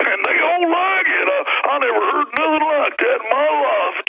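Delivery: high, slow mocking Southern lawman; mocking a cop's put-on accent, pitched high and slow; fake drawling cop accent. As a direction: sneer it out as a whiny sheriff. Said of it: "he's probably even putting that accent on. It sounds so fake".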